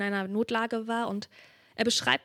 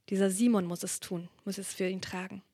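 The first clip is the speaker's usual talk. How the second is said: normal talking voice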